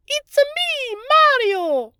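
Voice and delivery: very high, loud cartoon Italian Mario voice; Mario impression. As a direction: burst out like the video-game plumber. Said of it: "the guy was a perfect Mario".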